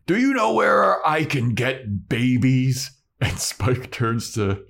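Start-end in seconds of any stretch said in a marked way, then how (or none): 0.1-2.9 slow, declaiming troll voice. Retold or imitated. Hungry troll asking Spike, slow declaiming troll voice. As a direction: ask it as a big troll, drawing out the words. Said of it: "the troll goes up to Spike".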